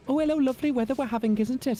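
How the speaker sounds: very high, sing-song Welsh greeting; Welsh accent. Acted. Friendly passer-by making weather small talk, Welsh accent. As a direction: put on a chirpy, sociable Welsh voice. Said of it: "that's more Welsh".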